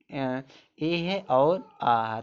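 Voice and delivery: ए and आ sounded out very slowly; teacher to class: sound out ए and आ slowly, one by one; slow vowel demo. Shown as a demonstration. Teacher spelling out the vowel sounds of केयर, very slow. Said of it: "एक उच्चारण देखो".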